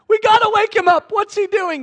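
shrill high panicked cry; frantic voices begging in the storm; panicked disciples. Acted.

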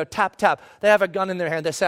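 usual speaking voice

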